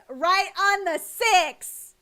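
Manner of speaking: slow grand proclamation; dramatic announcer. Pretend. Dramatic placing on tier.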